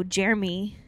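ordinary talk, nothing marked

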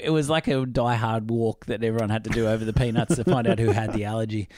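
usual speaking voice

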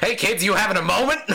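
high, fast, mocking voice; mock Yazan interrupting a tender moment; taunting villain. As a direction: sneer at the couple, quick and high. Voicing Yazan taunting the pair.

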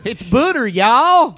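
put-on Southern accent. Voicing Paula Deen voice from above.

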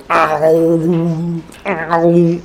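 slow drawn-out imitated pedal ow; filter sound effect. Shown as a demonstration. Showing how hard strumming sounds: slow mock ow. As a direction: stretch the ow like a guitar effect. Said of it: "So you hit your strings hard, it go".

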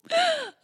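high, sharp, teary intake of breath; real gasp on the edge of crying; tearful gasp. Real, not acted. Mother moved by daughter's painting, tearful gasp. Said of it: "I'm about to cry".

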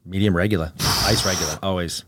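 low Boston accent, dropped R; New England accent. Shown as a demonstration. Repeating Boston words on request, New England accent. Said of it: "repeat it in your best New Englander accent".

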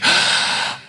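big, sharp intake of air; shocked gasp. Acted. Audience aghast at seeing her, gasping. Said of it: "The audience had to suck air".

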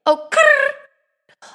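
squeaky growl. Real, not acted. Sudden noise mid-advice.

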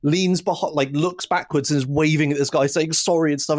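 usual speaking voice

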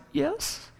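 very high, very soft little yes; a timid squeak of agreement; tiny voice. A narrator's reading. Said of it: "and said in a tiny voice".

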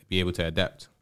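ordinary talk

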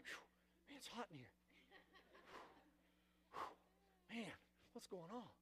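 very soft, low, unbothered aside; barely notice, absorbed in the TV; acted low-key puzzlement, almost to himself; oblivious mumbling. Oblivious while house is on fire.

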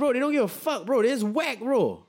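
high-pitched, animated storytelling; excited high rant. Retold or imitated. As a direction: get worked up, voice way up. Describing police caning people.

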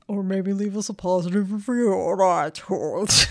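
painful silly voice, kept up; strained put-on voice. Host asking listeners for a review, straining a silly voice. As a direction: push the plug through a straining comic voice. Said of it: "This really hurts".

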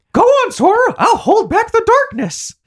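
very high squeaky mouse voice; Mickey Mouse voice. Retold or imitated. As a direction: pitch way up like a cartoon mouse. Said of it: "and he's like".

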